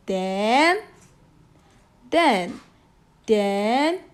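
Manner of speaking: "then" with a clear pause after each; "then" said alone, then a break. Shown as a demonstration. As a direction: let each "then" stop at the comma. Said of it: "Do you hear the small pause".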